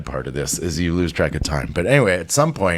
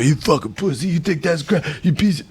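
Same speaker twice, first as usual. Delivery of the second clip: bullying inmate; 8 semitones higher than usual. Retold or imitated. Con bullying other inmates.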